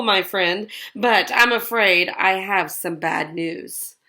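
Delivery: plain reading voice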